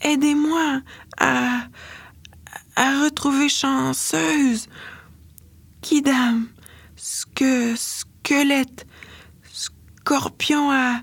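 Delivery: a hint of a delirious girl listing names mechanically; robotic listing. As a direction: rattle the names off like a machine, lost in fever. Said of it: "comme un robot".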